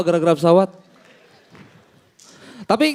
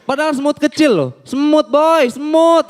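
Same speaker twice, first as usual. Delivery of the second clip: higher scoffing insistence; 6 semitones higher than usual. Acted. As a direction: scoff it's only an ant. Scoffing at crying kids. (mengejek dengan nada tinggi)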